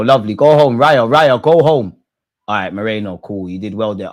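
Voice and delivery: normal talking voice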